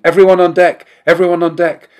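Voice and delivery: high, loud alarm shout; yelling. A narrator's reading. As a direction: yell the warning across the deck. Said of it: "He heard sirens and people yelling".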